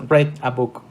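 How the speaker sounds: short e in READ, rhyming with "bed"; READ como "red". Shown as a demonstration. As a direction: READ pronounced like the colour red. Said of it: "se pronuncia como si fuera el color rojo". (READ pronunciado "red", como el color rojo)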